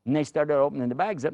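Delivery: ordinary delivery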